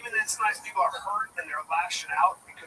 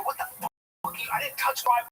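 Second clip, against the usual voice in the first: retold outburst, pitched up. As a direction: toss the line up high in disbelief. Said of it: "I go".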